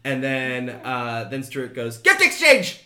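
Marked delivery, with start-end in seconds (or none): 2.0-2.8 high, very loud gleeful shout; excited scream. Acted. Stuart announcing the party game to everyone, excited scream. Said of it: "he just screams it at you all".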